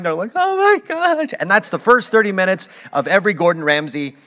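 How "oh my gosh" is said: high, wailing imitation of tearful owners; mock crying. Berated restaurant owners breaking down, high mock crying. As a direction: whine it out as if sobbing. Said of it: "and they're crying".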